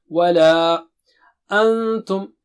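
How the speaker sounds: "ولا" held long with the vowel doubled; stretched mad. Shown as a demonstration. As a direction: stretch "ولا" twice, then "أنتم". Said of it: "so we'll stretch twice".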